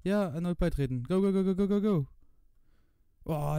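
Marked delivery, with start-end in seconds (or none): none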